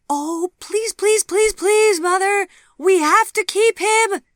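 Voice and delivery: put-on very high, loud child pleading; shouted child's plea; begging shout. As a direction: beg loudly like a small girl. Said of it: "Sophie shouted".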